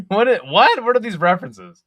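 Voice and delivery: incredulous at strange names, a laugh first; baffled disbelief. Real, not acted. Streamer reading chat, baffled by odd usernames.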